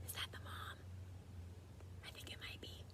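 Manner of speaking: hushed conspiratorial whisper. Real, not acted. Reader to kids, whispering a playful guess. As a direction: lean in and whisper the joke. Joking aside to children.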